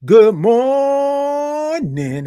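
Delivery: long sung-out "good morning" drawn high and slow; drawn-out morning show greeting; stretched good morning. Shown as a demonstration. Signature drawn-out greeting to viewer.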